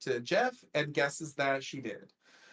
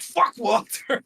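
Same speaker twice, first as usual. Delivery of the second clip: higher, louder, drawn-out dismissal with a laugh; hammed-up curse, laughing through it; mock hostile outburst; 6 semitones higher, 6 dB louder than usual. Voicing Shauna's scorn for co-host, mock hostility.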